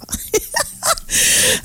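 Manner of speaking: high, shrieking giddy squeal; squealing laugh. Real, not acted. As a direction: squeal with delight. Squealing laugh after swoon.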